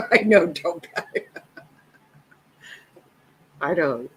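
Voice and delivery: talking through real laughter; amused reply to a viewer's comment; laughing. Real, not acted. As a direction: laugh while answering.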